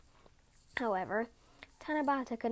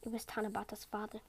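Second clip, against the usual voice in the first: usual speaking voice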